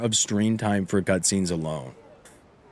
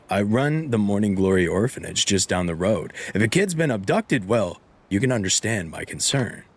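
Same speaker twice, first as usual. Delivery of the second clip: ordinary talk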